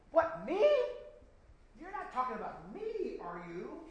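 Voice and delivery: high, incredulous protest; indignant surprise. Acted. Preacher voicing an accused listener, mock indignant. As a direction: play the accused listener, stunned. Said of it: "They're gonna ask the question".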